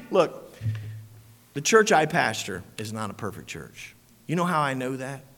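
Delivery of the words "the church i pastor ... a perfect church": ordinary talk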